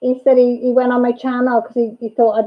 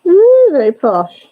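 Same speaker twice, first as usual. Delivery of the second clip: long, high, loud swooping "ooh"; playfully impressed; 7 semitones higher, 6 dB louder, slower than usual. Real, not acted. Mum admiring child's gold gun wrap, playfully impressed. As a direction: tease with drawn-out mock awe.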